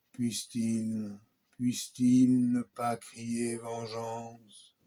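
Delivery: slow, measured declamation with long pauses between phrases; solemn poem recitation. Acted. Performer reciting a Holocaust victim's poem to listeners, solemn and slow.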